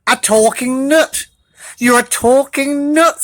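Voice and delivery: high, slow wonder; amazed. Acted. Animals amazed at the talking nut, high. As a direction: gasp it in disbelief.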